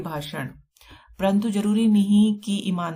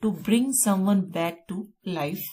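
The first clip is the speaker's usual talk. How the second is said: very slow, deliberate dictation; much slower than usual. Shown as a demonstration. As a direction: read each word slowly for notes. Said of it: "teacher dictating definition".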